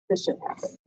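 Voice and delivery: unvoiced under-the-breath aside; whispered aside. Real, not acted. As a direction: drop to a whisper for the swear. Muttered aside about bad events.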